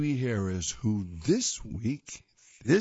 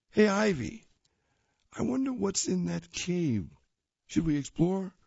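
higher curious cub voice; curious kid bear; 6 semitones higher than usual. Acted. Cub asking Ivy about the cave, higher curious voice. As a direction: wonder aloud like a small bear.

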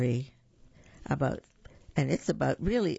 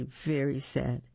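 ordinary delivery

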